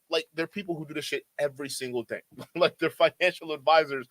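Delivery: normal talking voice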